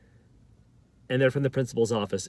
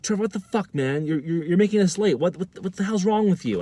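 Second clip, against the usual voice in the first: higher-pitched mock outrage; retold anger; 7 semitones higher, 3 dB louder than usual. Waiting students blaming the storyteller for making them late, angry. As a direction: imitate an angry, exasperated crowd. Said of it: "They're very angry at me".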